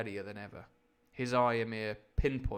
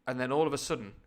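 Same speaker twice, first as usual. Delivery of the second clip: ordinary delivery, nothing marked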